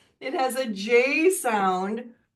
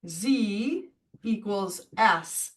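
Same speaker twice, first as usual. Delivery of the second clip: Z equals S said very slowly; slow dictation; 2 semitones lower, 4 dB quieter, much slower than usual. Shown as a demonstration. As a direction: spell it out slowly. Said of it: "So I'm gonna write here".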